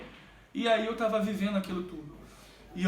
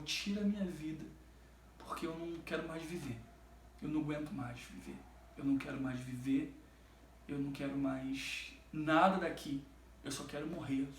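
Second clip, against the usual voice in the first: much softer, desperate prayer kept low; retold hushed plea; 8 semitones lower, 11 dB quieter than usual. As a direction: pray quietly so mother won't hear. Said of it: "Eu não queria falar alto pra minha mãe não ouvir". (súplica baixinha e desesperada)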